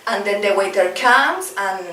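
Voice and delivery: ordinary talk, nothing marked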